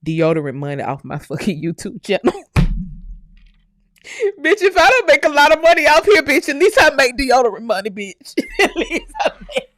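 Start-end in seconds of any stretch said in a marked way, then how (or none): 4.4-8.3 louder, higher defiant brag with a laugh in it. Real, not acted. Bragging to online critics about her YouTube deodorant money, loud and defiant. 8.5-9.7 breaking into high laughter mid-line; laughing. Real, not acted. Laughing at her own brag to viewers, words breaking up.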